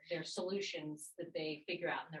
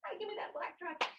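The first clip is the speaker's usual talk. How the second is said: put-on high, whiny child grabbing a toy; a little kid demanding the truck; 13 semitones higher, 4 dB quieter than usual. Kids fighting over a toy.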